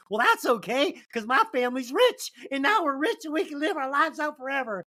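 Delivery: hammed-up happy-ending bride; mock sugary heroine. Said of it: "Walt Disney garbage".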